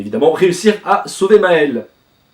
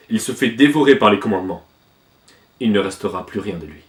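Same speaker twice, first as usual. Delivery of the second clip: much lower, sombre explanation; deep grave character voice; 4 semitones lower than usual. Acted. King explaining that Maël is beyond help, deep and grave. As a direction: speak low and heavy, like bad news.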